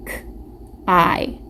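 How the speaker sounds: ordinary delivery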